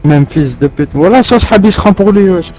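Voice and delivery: high, loud furious yelling; raging gamer. Real, not acted. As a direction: scream it in rage. Player rages, shouting.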